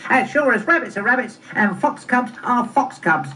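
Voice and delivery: high, emphatic cub voice; high-pitched, certain fox cub; third fox cub. Acted. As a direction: recite it like a little cub's catchphrase. Third cub repeats catchphrase.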